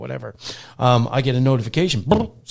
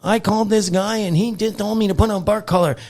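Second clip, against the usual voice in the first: higher, indignant mock complainer; higher, huffy imitation of the angry reviewer; 9 semitones higher, 5 dB louder than usual. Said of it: "she's like".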